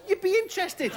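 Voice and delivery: very high, squeaky exclamation; exclaimed punchline. Acted. Comic imagining a real giant turtle, exclaimed. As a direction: shoot the pitch right up in mock outrage. Emphatic punchline, then played down.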